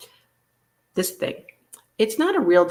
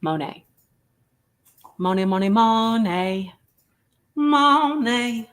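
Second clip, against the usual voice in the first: singsong Monet; 5 semitones higher, 4 dB louder, slower than usual. Acted. Chanting Monet with rising voice.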